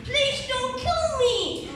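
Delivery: high, slow begging plea; pleading mouse. Acted. Mouse begging the lion for mercy. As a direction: beg for your life, small and high.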